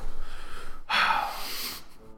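long, voiceless sigh; distressed sigh. Acted. Uncle resigns himself to walking, long distressed sigh. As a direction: let out a long, heavy breath.

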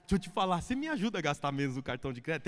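sweet coaxing. Retold or imitated. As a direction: coax his wife tenderly. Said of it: "O marido fala assim".

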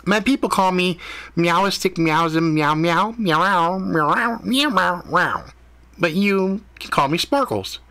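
cat naming itself. A narrator's reading. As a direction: drag out the meows proudly. Cat gives its meow name.

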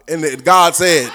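loud, slow, drawn-out pronouncement; put-on pulpit proclamation; mock preacher voice. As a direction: stretch it out like a pompous preacher. Repeats the mocked preacher phrase.

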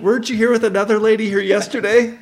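high, loud mock-nosy shout; yelling over. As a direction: call out to strangers across the way. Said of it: "and you yell over".

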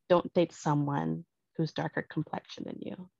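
normal talking voice

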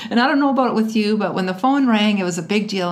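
ordinary talk, nothing marked

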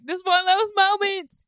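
high, loud excited character impression; Scooter. Imitating a game character as the floating city crumbles, high and loud. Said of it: "Scooter's like".

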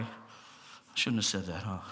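low, quiet, slow apology under the breath; sheepish aside. Real, not acted. Preacher to congregation after a jab at preachers, quiet sheepish aside. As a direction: mutter it like a guilty afterthought.

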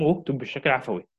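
ordinary talk

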